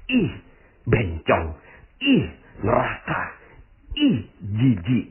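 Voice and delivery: mocking the people who sneer, slow and drawn out; imitating a judgemental onlooker's contempt; disgusted sneer. Speaker mimics people judging a passerby, with slow disgusted sneering. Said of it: "ngomongnya gini".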